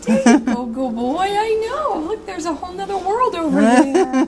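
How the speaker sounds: sing-song reassurance, pitched right up; cooing pet talk. Real, not acted. Soothing the cat about the world over there, high cooing.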